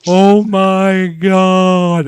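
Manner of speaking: very slow, loud, high wail; dad's slow-motion cry. Retold or imitated. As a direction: stretch every word out in a loud cry. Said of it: "I hear my dad going".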